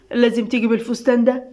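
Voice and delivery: usual speaking voice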